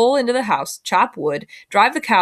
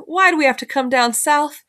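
higher, pointed call to mama; a girl calling mama for show, mildly raised; loud enough to overhear; 7 semitones higher than usual. A narrator's reading. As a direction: say it so the boy hears. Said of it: "loud enough for me to hear".

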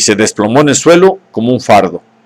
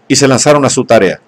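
ordinary delivery

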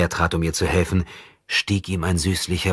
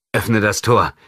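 firm command; 4 semitones higher, 5 dB louder, slower than usual. A narrator's reading. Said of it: "befahl er".

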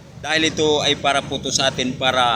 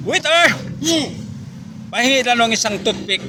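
calling the waiter; 7 semitones higher than usual. Retold or imitated. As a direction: shout the order across the room. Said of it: "Umorder siya".

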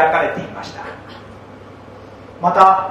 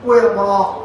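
normal talking voice

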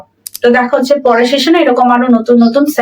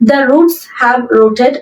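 very slow, word by word dictation; slow model sentence; much slower than usual. Shown as a demonstration. Teacher reading the example sentence aloud to students, very slow and clear. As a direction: spell it out slowly for learners. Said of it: "আমাদের আগে লিখতে হবে".

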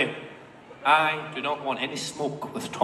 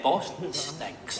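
softly and slowly, like a secret; slow, hushed retelling of a friend's jab; quiet slow quote; 2 semitones lower, 9 dB quieter, much slower than usual. Retold or imitated. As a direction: hush it out, dragging the words. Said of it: "he said".

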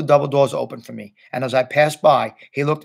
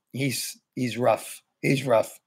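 ordinary delivery, nothing marked

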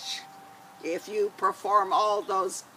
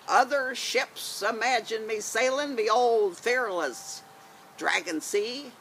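pirate voice. Acted. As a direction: boom it out like an old sea dog. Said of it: "gonna be Janie with her pirate voice".

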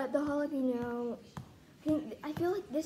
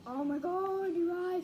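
burning mouth exclaim; 6 semitones higher, slower than usual. Real, not acted.

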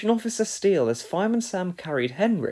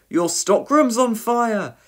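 slow, raised, loud alarm; shouted warning; 7 semitones higher, 6 dB louder, slower than usual. A narrator's reading. Trevor looking for the fire extinguisher, shouted warning. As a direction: shout it out, stretching the words. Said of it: "he shouted".

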